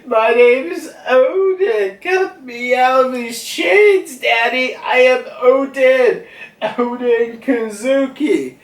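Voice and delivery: put-on high, loud, drawn-out child voice; child's tantrum. Young Yamato chained up and demanding release, tantrum shouting. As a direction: wail defiantly like a kid throwing a fit. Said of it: "a tantrum for the week".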